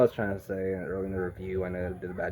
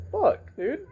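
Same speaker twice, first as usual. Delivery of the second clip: squeaky high mock curse, laughing; imitating Yelena high and giddy; high mock exclaim. Yelena swearing at the shooting, in a high squeaky voice. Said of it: "Yelena's all like".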